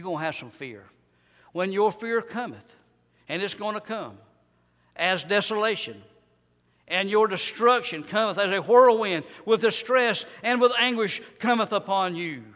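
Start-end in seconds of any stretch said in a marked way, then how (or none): 1.5-12.4 higher, louder scripture declamation; full-force preaching. Acted. Preacher warning sinners of coming destruction, full-force preaching.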